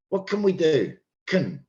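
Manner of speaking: "can" reduced to "kun"; weak "can". Shown as a demonstration. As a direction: shrink "can" to a short unstressed "kun". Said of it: "So I've changed the word can".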